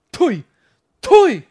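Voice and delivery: escalating furious shout; angry yell. Real, not acted. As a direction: blow up at the screen. Raging at the cable provider.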